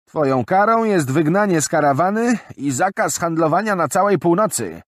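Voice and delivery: high, loud formal verdict; stern old merchant woman. Acted. Old woman declaring Jarak broke the code, stern. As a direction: pronounce judgment firmly.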